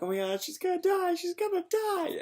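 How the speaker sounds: high, squeaky hammed-up panic; mock panic. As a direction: shriek it like a fan who's sure she's dead. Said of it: "And then I'm like".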